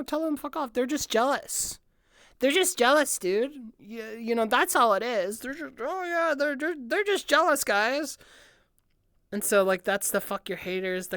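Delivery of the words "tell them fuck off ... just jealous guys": higher-pitched hammed-up brush-off; mock dismissive. Brushing off haters who call it stupid, mock dismissive. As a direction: play at waving off the critics.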